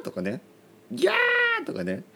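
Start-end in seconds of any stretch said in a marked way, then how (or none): 0.9-1.7 high comic shriek; hammed-up falsetto scream; panicked "gyaa" scream. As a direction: shriek in mock horror. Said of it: "そういう声を出します".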